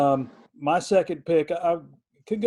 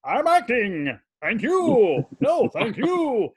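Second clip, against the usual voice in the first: Master Thespian impression; 8 semitones higher, 3 dB louder, much slower than usual. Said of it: "we'd walk around going".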